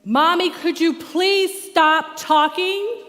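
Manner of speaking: put-on high, loud, slow pleading child voice; a little kid begging mom to stop; fed-up child. Son in the back seat begging mom to stop, slow loud whine.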